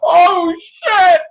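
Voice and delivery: yelled in shock, high and loud; shocked shout. Retold or imitated. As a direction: blurt it out in horror. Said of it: "I'm like".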